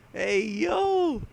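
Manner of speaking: high-pitched amazed whoop, easing down; excited reaction. Retold or imitated. Fan reliving spotting a Tekken move, excited outburst. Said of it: "and I was like".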